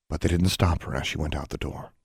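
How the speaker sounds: ordinary delivery, nothing marked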